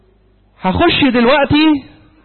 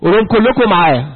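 ordinary talk, nothing marked

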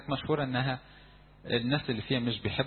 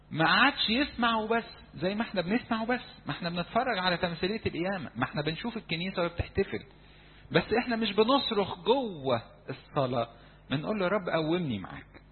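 fervent preaching; 7 semitones higher than usual. Real, not acted. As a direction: press the congregation with urgency. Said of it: "preaching at full force". (وعظ حماسي بصوت عالي)

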